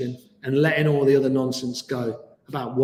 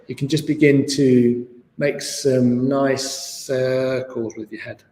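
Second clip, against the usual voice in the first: guided meditation; 2 semitones lower than usual. Acted. Leads guided body scan.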